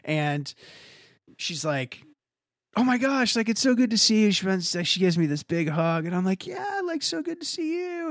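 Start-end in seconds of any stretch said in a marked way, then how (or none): 2.8-4.3 high, bright imitated happy girl; mock-cheery hello from his girlfriend; delighted greeting. Ex greets him warmly. 6.5-8.1 very high, soft mocking falsetto; self-mocking high soft echo of her words; awkward mock reply. His awkward reply voiced.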